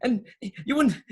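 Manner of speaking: high, indignant little crook; flustered underling. Acted. Underling corrects his boss about his name, flustered. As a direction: protest in a high, loud, stammering voice.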